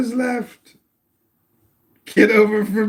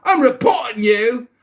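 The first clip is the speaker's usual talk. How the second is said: much higher, faster mock English accent in a hammed-up tirade; 4 semitones higher than usual. Mock English accent, ranting to Tuna Boy before muting. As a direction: play an outraged Englishman reporting the account. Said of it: "my English accent is so mocking".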